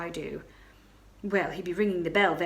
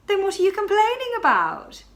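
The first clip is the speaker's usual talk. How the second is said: incredulous; 12 semitones higher, 8 dB louder than usual. Acted. Marchioness amused incredulous.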